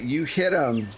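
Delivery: usual speaking voice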